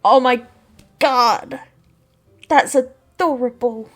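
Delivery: loud, drawn-out delight at something cute; loud gushing. Real, not acted. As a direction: gush loudly over how adorable it is. Player gushes at the cute scene.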